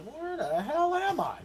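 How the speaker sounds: put-on high-pitched bewildered baby voice; mock wide-eyed confusion in a high voice; wide-eyed newborn. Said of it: "looking around wide-eyed".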